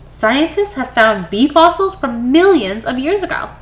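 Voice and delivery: plain reading voice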